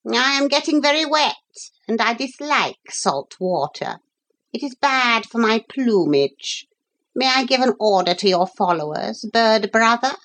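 high, formal parrot voice; polite parrot in trouble. Acted. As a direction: state your plight as a courteous parrot. Parrot explains plight to gulls.

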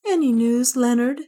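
an anxious queen asking; Juana. Acted. Juana to Leonard, anxiously asking for news.

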